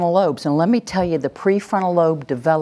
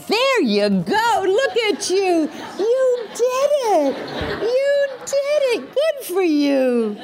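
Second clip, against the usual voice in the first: much higher, slow, laughing praise; over-the-top praise; 14 semitones higher, slower than usual. Shown as a demonstration. Parent gushing praise as the child obeys. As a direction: gush over her like it's a triumph. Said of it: "without getting so crazy at first".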